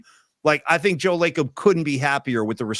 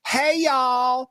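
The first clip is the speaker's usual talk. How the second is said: high, drawn-out Texas greeting; Connie Britton; 8 semitones higher than usual. Retold or imitated. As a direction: greet the room in a light Texas accent. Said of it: "with that Texas thing kinda going".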